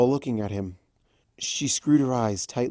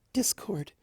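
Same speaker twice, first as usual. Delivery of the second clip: high, soft murmur; 9 semitones higher, 7 dB quieter than usual. Acted. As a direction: murmur his name. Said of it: "Fluttershy murmured".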